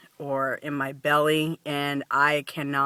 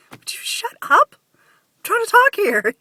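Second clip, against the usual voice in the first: a much higher, louder squeaky snap at her own stomach; mock scolding; 6 dB louder than usual. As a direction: scold the noisy belly in a high, hammed-up voice. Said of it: "And I'm like".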